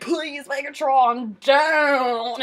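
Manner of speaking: slow, drawn-out mock plea; acted dying plea. Fan re-enacting a death scene, mock pleading. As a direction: stretch each word as a hammed-up plea.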